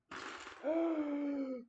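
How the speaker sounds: high sharp gasp of alarm; shocked gasp. Real, not acted. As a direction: gasp as something seems broken. Discovers the figure's wing missing.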